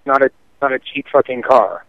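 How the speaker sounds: ordinary talk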